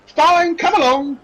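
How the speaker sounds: put-on high, impatient serving girl; brusque barmaid. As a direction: hustle the guests along.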